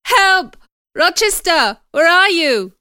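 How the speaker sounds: high, very loud panicked shout; shouted cry for help. A narrator's reading. Injured man calls Rochester from upstairs, desperate shout. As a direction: scream out for rescue. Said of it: "A man's voice shouted".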